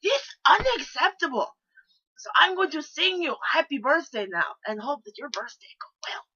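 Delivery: put-on loud, high outburst in a Russian accent; mock outrage with a Russian accent, easing off at the end; indignant Russian. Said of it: "And then I slam hand on desk".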